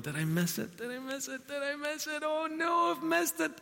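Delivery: rising panic. Retold or imitated. Inner voice fearing a missed chance.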